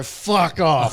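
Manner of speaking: loud, high retold exclamation; stunned yell, acted out; astonished disbelief. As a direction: blurt it out in mock disbelief. Retelling his astonished reply.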